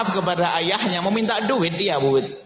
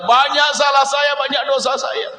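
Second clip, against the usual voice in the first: impassioned confession; 8 semitones higher, 3 dB louder than usual. Real, not acted. Preacher confesses own sins.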